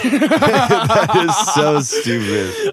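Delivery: laughing it out, high and slow. Real, not acted. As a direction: crack up through the words. Laughing at the league.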